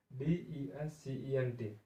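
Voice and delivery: spelled out slowly; slow, separated letters for learners. Shown as a demonstration. Teacher spells out the word. (एक-एक अक्षर धीरे-धीरे)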